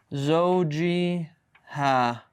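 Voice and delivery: drawn-out زوجها, "zaw-jii-haa"; kasra demonstration. Shown as a demonstration. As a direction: say زوجها very slowly with an "i" after the j. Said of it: "that's why the kasra came".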